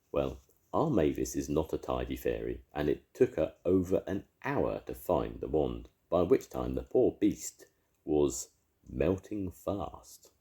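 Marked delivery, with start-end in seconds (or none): none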